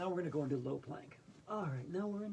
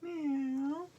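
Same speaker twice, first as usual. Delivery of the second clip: cat meows; 5 semitones lower, much slower than usual. Pretend. Yoga teacher voicing animals in cat pose. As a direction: meow like a cat, then moo low. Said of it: "Now cat pose".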